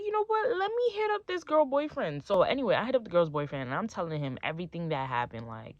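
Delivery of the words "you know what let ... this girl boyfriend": pitched way up, a bright idea striking; sudden resolve. Retold or imitated. Girlfriend deciding to contact the other boyfriend, high-pitched resolve. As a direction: announce a scheme in a high voice. Acts out sudden scheming decision.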